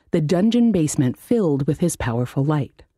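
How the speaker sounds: ordinary delivery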